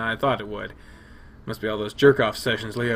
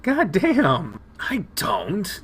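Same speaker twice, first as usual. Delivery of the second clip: high, amazed holy shit; gasping in surprise; surprised; 10 semitones higher, slower than usual. Real, not acted. Discovering breaker box.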